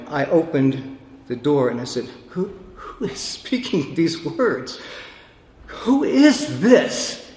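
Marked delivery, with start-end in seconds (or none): 2.3-7.3 wondering question. Retold or imitated. Retold asking through the door.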